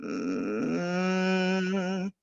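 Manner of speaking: long creaky mm rising into a hum; drawn-out creaky hum; fry into hum. Shown as a demonstration. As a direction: slide from vocal fry up into a held hum. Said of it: "like, a vocal fry underneath a hum".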